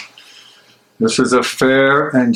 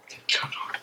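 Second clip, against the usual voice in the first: breathy whisper to himself; a private, frightened whisper; 16 dB quieter, faster than usual. Acted. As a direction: let it fall to a bare whisper.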